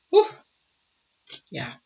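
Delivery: ordinary talk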